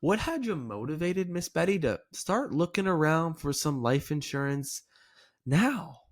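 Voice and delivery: gently inquisitive with upward inflection on "now"; curious. Shown as a demonstration. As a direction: wonder aloud, lifting and stretching "now". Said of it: "this is an upward inflection".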